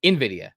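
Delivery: "nvidia" loudly as in-VID-ee-uh; practised pronunciation. Shown as a demonstration. Host tests himself after the slip, "nvidia" as in-VID-ee-uh. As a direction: test out "nvidia" with a firm "in". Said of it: "Yeah, you're right".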